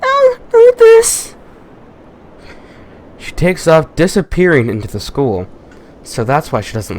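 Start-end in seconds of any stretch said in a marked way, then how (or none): 0.0-1.3 very high, slow, loud falsetto; mock girly falsetto. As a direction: ham it up as a squealing girl.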